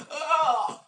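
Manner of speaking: a sudden high yelp of fake alarm; mock shocked shriek. Telling viewers how everyone reacted, a high mock shriek. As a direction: shriek like you just saw someone collapse.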